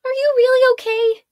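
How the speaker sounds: high, loud urgent question; acted astonished concern, high and loud; urgent disbelief. A narrator's reading. Anxious check on revived friend.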